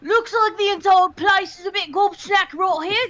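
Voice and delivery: high, loud Cockney swagger; loud Cockney bravado; Cockney accent. Acted. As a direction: play a brash Cockney tough. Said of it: "I've always wanted to try Cockney".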